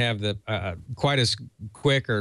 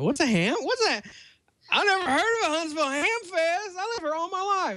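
high-pitched Southern lady, amused; Southern Birmingham accent. Retold or imitated. Retelling the Cracker Barrel lady in a Southern accent. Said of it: "lovely Birmingham, uh, accent".

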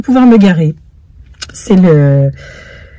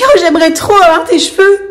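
higher, laughing envious gush; imitating Elsa; 8 semitones higher than usual. Elsa wishing for her mother's hair, lightly imitated higher. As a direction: play the admiring girl. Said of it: "Elle me dit".

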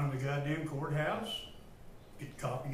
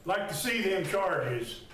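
angry raised voice; 3 semitones higher than usual. Real, not acted. Detainee challenging officers over the charges, angry raised voice. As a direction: still heated, voice up. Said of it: "I raised my goddamn".

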